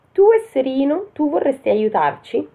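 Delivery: high-pitched disbelieving question; incredulous thief. Acted. Ladri increduli a Pollicino. (ladro incredulo, tono acuto)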